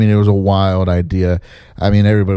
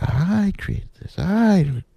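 high, soft, slower mocking whine; 11 semitones higher, 5 dB quieter, much slower than usual. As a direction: parody his proud claim with a sneer. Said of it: "'Cause he's like".

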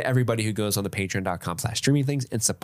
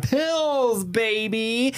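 much higher, bouncing on the name; hype shout-out; 12 semitones higher, much slower than usual. Real, not acted. Host shouting out a patron, much higher and hyped. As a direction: sell the name like a hype man.

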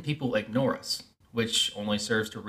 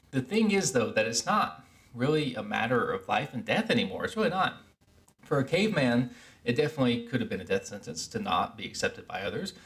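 normal talking voice

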